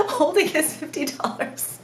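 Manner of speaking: words through a real laugh; half-laughing at her own misfortune. Real, not acted. Laughing at the memory.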